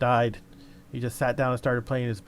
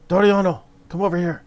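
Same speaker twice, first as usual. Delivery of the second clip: higher, louder call across the camp; 7 semitones higher, 9 dB louder than usual. Acted. Guard summons his leader to meet the stranger, loud call. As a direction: call the boss over.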